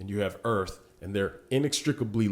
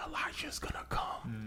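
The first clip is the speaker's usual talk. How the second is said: soft breathy reveal; dramatic whisper; 12 dB quieter than usual. Acted. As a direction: drop to a secret whisper.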